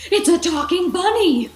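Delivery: very high, loud terrified cry; frantic shout. Acted. Rex warning the toys about the bunny, shrieking. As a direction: shriek it like the sky is falling. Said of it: "Rex shouted".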